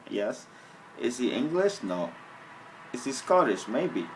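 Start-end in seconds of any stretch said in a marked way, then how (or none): none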